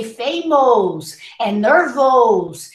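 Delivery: "famous" and "nervous" ending in long ohs; Portuguese-style spelled-out o in "famous" and "nervous"; reading the letters. Shown as a demonstration. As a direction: say fay-mohs and ner-vohs for "famous" and "nervous". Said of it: "you're trying to read the letrinhas".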